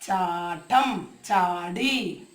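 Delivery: teacher slowly demonstrating new words; slow modelling. Shown as a demonstration. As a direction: draw out each word gently for children. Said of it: "ചേർത്ത് നോക്കാം".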